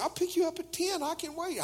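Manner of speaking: high, eager rush to agree; over-eager teen boy. Retold or imitated. Teen boy promising his sweetheart he can wait, eager. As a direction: jump at it in a high voice.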